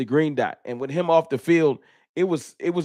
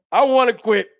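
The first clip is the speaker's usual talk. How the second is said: laughing; 8 semitones higher, 6 dB louder than usual. Real, not acted. Laughing while venting.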